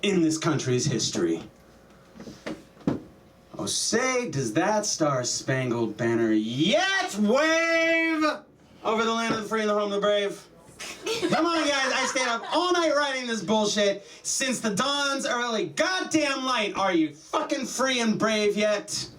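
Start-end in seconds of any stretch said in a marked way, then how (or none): 3.6-10.5 high-pitched demand, stretched then rushed; impatient demand. Acted. Comic voices Francis Scott Key quoting his anthem, impatient. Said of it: "the most impatient man". 11.3-19.1 high, badgering impatient rant; exasperated impatience. Comic as anthem writer up all night, exasperated impatience. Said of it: "Very impatient".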